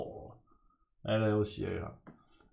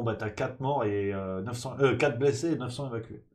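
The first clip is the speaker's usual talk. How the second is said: ordinary delivery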